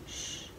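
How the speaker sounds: soft shush for quiet. Real, not acted. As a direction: hush the room with a shh. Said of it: "you have to be quiet".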